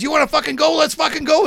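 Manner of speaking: high, loud mock challenge; retold screaming match. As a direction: imitate someone picking a fight. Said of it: "they're screaming at each other".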